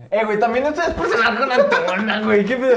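loud high laughing protest; laughing teasing. Real, not acted. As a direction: tease him through laughter.

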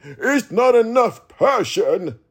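mocking critic, slow. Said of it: "Do you not hear the energy in his voice?".

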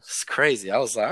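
usual speaking voice

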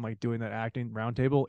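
ordinary delivery

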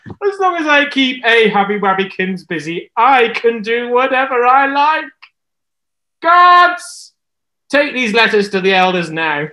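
high, loud, scheming villain voice; gloating queen. Acted. Jezebel gloating alone, then calling her guards. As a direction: crow it like a wicked queen.

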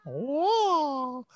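high, loud martial arts shouts; kung fu movie cry. Pretend. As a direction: yell like a kung fu movie fighter. Mock kung fu fight cries.

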